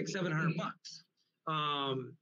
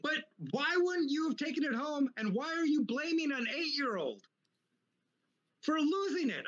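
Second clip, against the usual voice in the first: indignant retold rant; 10 semitones higher than usual. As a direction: re-enact an argument in a strained high pitch. Retold quarrel with the ex-wife.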